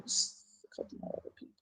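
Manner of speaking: whispered aside. Real, not acted. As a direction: drop to a whisper, confiding. Drops voice mid-story.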